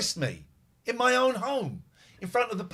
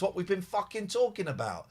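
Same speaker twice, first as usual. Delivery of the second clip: ordinary delivery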